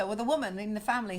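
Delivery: normal talking voice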